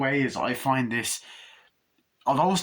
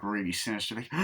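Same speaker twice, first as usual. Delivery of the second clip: ordinary delivery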